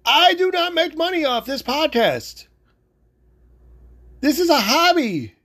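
insistent, high-pitched protest; worked-up yelling. Real, not acted. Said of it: "yelling at you".

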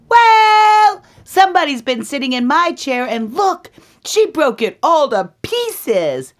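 very high, loud, fast squeal; upset Baby Bear. Acted. Baby Bear finds his chair broken, high loud squeal. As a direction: wail the complaint as a baby bear. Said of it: "And then Baby Bear says".

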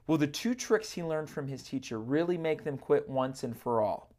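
usual speaking voice

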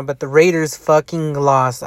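ordinary talk

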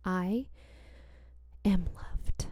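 soft whispered words; whispered affirmation. Shown as a demonstration. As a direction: breathe the words out gently. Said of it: "So repeat after me".

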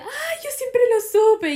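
high, loud know-it-all mother-in-law; a loud, high-pitched know-it-all mother-in-law. Retold or imitated. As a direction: play the smug mother-in-law. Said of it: "Mi suegra decía".

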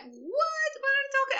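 high squeaky mock disbelief with a laugh; incredulous. Film fan reliving the church twist as an audience, incredulous. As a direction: shoot the pitch right up, baffled. Acting out own shock as viewer.

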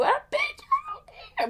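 tiny, very high squeak of annoyance; exasperated squeal. Acted. Her cutting into the letter she retells, high exasperated squeal. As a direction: squeak it out, fed up.

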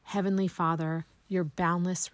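usual speaking voice

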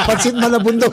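words through laughter; laughing. Real, not acted. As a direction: say it while still laughing. (habang tumatawa)

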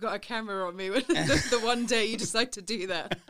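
amused mock complaint while laughing; laughing at the timing. Real, not acted. Laughing about the camera.

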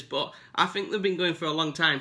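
ordinary talk

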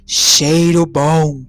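very slow, booming giant; thundering giant voice. Acted. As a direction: rumble it out like a hungry giant. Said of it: "disse com sua voz de trovão". (voz de trovão do gigante)

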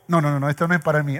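mock hasty refusal. Preacher mimics a reader refusing a verse, fast mock rush. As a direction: rattle it off like brushing the verse away.

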